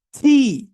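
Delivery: "থি" with a strong puff of air, loud; aspirated T. Shown as a demonstration. Teacher models the letter T, aspirated T. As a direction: punch out "থি" breathily. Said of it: "এটাকে না বলে আমরা আসলে বলি টি".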